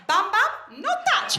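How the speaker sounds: loud, high mock-Italian toddler outburst; toddler's Italian accent. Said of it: "until she whispers in his ear".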